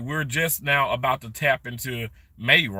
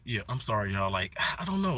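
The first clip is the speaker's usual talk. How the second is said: ordinary talk, nothing marked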